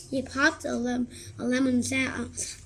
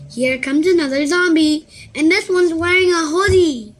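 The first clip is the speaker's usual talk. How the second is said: loud, higher mocking jeer; 5 semitones higher, 10 dB louder than usual. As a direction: jeer at the newcomer. Rip mocking loudly.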